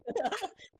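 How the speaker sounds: a quick high burst of laughter; short laugh. Real, not acted. Laughs at teammate's joke.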